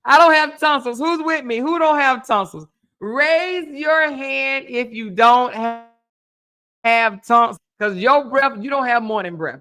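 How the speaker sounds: rally the crowd to raise their hands; calling out. Real, not acted. Asking viewers without tonsils to raise hands, loud call.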